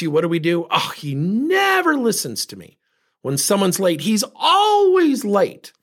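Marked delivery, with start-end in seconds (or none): 0.7-2.8 high, drawn-out mock exasperation; exasperated complaint. Someone interrupted complains about the other, mock exasperated. Mimics overreaction to being interrupted. 4.0-5.7 high, stretched-out mock complaint; exaggerated exasperation. Someone kept waiting complains, hammed-up exasperation. Mimics overreaction to someone's lateness.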